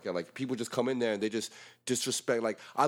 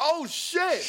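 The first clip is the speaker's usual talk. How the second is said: very high-pitched retold exclamation; shocked outburst; 4 dB louder than usual. Retelling the moment hyung slipped out, shocked cry. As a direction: re-enact the shock, pitch leaping up.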